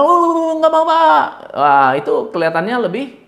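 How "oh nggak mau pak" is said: much higher, louder quick refusal; hasty refusal. Shown as a demonstration. Coach shows a flustered refusal to the boss, high and hurried. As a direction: blurt the no out fast. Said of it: "tidak berwibawa".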